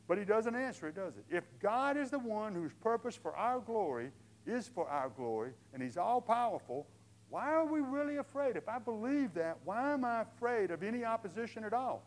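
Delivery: loud, high fervent preaching; full-force preaching. Acted. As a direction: drive the point home to the congregation.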